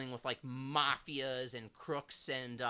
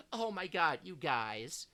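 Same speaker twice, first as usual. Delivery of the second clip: ordinary talk, nothing marked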